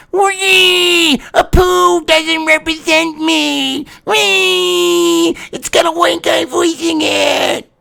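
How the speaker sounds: high-pitched, slow, wailing mock whine; mocking whiny crybaby. Ranting host mocks a comedian's complaint with a high wailing whine. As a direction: ham up a shrill crybaby complaint. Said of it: "needed to get some fucking attention and went".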